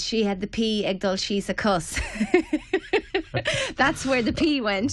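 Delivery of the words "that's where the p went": laughing. Real, not acted. Laughing at the punchline.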